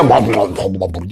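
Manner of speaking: mocking mumble, dropped well below the rant; mock chatter. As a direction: imitate someone droning on pointlessly. Mimicking people's empty chatter.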